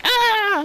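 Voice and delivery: hammed-up scream of fright; kids screaming. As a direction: shriek in cartoon terror. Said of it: "the kids are just like".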